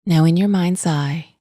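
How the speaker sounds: calm, even-pitched meditation voice with long pauses; guided meditation. Acted. Podcast host leading listeners through a stress visualization, calm meditation voice. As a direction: guide them gently into relaxation, leaving space between phrases.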